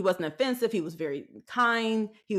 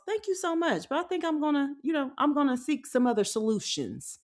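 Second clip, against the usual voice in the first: gently courteous, a little higher; polite decline; 6 semitones higher than usual. Shown as a demonstration. To folks offering dating advice, a polite higher voice. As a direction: decline them sweetly and politely. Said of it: "See how that sounds?".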